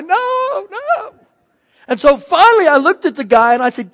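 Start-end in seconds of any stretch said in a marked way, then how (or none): 0.0-1.1 much higher, mock-childish pleading no; whiny scared kid. Grown man mimicking a scared child at the slide, high and whiny. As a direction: whimper like a frightened little boy calling for mom. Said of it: "I wanted to cry to my mom".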